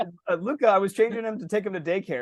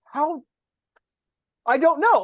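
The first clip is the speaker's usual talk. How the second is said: exasperated bafflement; 8 semitones higher, 4 dB louder, slower than usual. Retold or imitated. Retelling his confusion over son.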